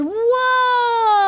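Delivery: a high, loud cry of amazement; amazed whoa. Real, not acted. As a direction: wow at the sight. Sees huge power pole.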